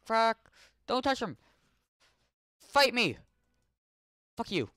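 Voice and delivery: high, loud fighting cries; real high angry shouts; furious yells. Real, not acted. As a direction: challenge the enemy loudly. Fights the attacker.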